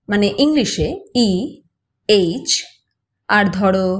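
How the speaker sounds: ordinary talk, nothing marked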